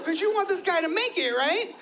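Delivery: ordinary talk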